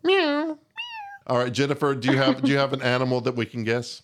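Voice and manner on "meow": high squeaky meow; tiny high kitten meow; cat meow. Pretend. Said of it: "Do, like, a meow".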